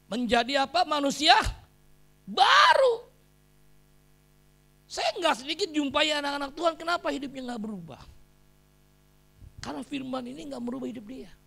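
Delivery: loud, high-pitched preaching shout; khotbah berapi-api. Real, not acted. Preaches at full force. (berkhotbah lantang dengan nada tinggi)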